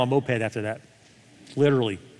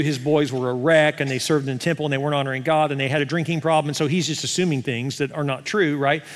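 normal talking voice

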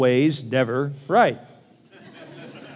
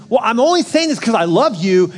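higher and louder, sugary excuse; mock-sweet justifying; 6 semitones higher, 5 dB louder than usual. Fixer to spouse, mock-sweet. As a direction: defend the nagging sweetly. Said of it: "And you say".

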